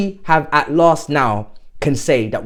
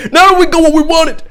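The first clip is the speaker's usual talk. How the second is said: cheeky laugh; 12 semitones higher, 7 dB louder than usual. Real, not acted. Cheeky apology to rivals.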